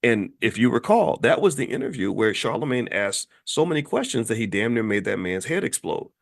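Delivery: normal talking voice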